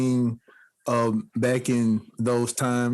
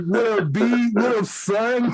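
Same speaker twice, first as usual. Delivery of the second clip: mock New York greeting; 7 semitones higher, slower than usual. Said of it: "They be like".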